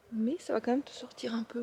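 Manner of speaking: soft unvoiced whisper to the cat. Real, not acted. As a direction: breathe it gently to the pet. (chuchoté doucement)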